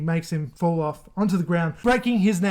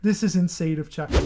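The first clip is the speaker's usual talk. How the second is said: usual speaking voice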